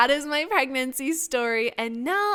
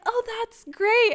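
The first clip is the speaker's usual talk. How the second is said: delighted; 9 semitones higher, slower than usual. Retold or imitated. Husband reassures with delight.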